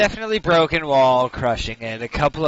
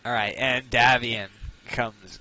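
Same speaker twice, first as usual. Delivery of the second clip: ordinary talk, nothing marked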